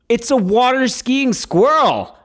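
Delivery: high, slow, gushing over something adorable; a hammed-up swoon over a cute pet; mock gushing.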